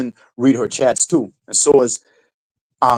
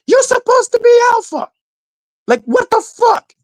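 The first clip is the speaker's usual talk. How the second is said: high, loud mocking squeal; taunting Ben about being alpha in a squeaky voice; 4 dB louder than usual. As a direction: shriek it in mock disbelief. Mocking Ben's alpha claims.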